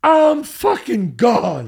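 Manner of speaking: drawn out, overwhelmed; smitten. Real, not acted. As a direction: lovestruck exclamation. Retelling being smitten.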